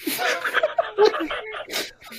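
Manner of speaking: very high, squeaky crying with laughter; helpless laughter. Real, not acted. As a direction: force the words out through hysterics. Helpless laughter while reading card.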